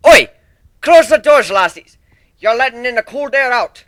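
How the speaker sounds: loud, high Scottish bellow; Scottish shout. A narrator's reading. Minotaur shouts at the ponies to shut the doors, Scottish. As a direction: call out across the hall in broad Scots. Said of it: "someone called in a distinctly Scottish voice".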